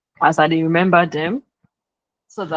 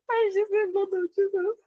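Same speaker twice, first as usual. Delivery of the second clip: laughing; 13 semitones higher, 6 dB quieter than usual. Real, not acted. Laughing at a classmate's exclamation.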